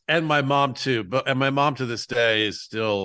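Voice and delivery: ordinary talk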